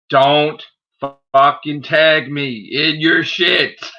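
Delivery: slow, emphatic demand, word by word; real exasperation. Real, not acted. Warning the man who keeps tagging them, slow exasperated demand. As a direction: drag out each word, completely fed up.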